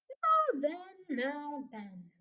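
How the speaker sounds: lightly voiced sergeant, measured calming; placating police sergeant. Sergeant steps into the quarrel, mildly placating.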